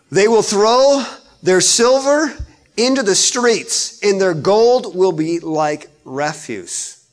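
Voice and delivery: loud, slow, declaiming scripture; booming pulpit reading; preaching at full force. Acted. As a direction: proclaim it slowly to the congregation.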